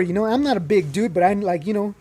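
ordinary talk

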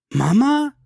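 sharp high cry of alarm; a daughter's shocked question, pitched high; startled daughter; alarmed gasp. A narrator's reading. Gina hears about her mother.

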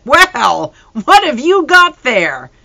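"what have you got there" loud and high, slow; booming father. Acted. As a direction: seru seperti ayah yang lantang. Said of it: "The booming voice of my father".

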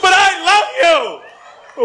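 loud, desperate plea; pleading shout. Acted. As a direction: cry out the love. Pleading lover in routine.

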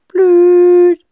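high, loud phone tone; phone ringing tone. A narrator's reading. Dial tone from the phone on speaker, beeping. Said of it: "tutet es aus dem Handy".

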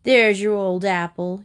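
ordinary delivery, nothing marked

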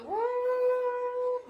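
high mock dreamy "uh"; dreamy moan. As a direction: sigh as if in a dream car. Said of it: "me estoy teletransportando".

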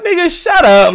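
high-pitched mock back-talk; imitated indignant comeback, pitched high; retold defiant retort. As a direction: answer back like a defiant student. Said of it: "We'd be like".

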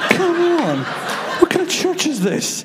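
hammed-up scandalised complaint; mock indignation. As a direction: protest in playful outrage, half laughing. Teasing protest at the timer.

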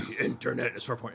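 puppet monster impression; Trekkie Monster. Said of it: "I'd wanna be Trekkie Monster".

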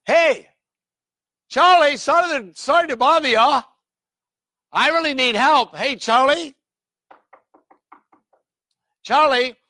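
high, loud call up to a window. Acted. Preacher acting the midnight caller, calling loudly.

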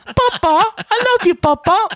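very high, exaggerated British child voice; hammed-up adoring little child; piping waif. Son mock-doting on his dad, piping British waif. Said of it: "you sound like Dobby with that voice".

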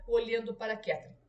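plain reading voice

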